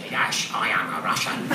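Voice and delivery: put-on very low Scottish accent with slushy S sounds; mock a Scottish star playing Russian in an unchanged accent; deep slurred-S Scottish impression; Sean Connery. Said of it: "he never changes his accent for anything".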